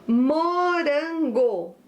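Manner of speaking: "Morango" drawn out syllable by syllable; slow dictation. Shown as a demonstration. Teacher gives pupils the next word, slow dictation. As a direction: stretch each syllable of "Morango".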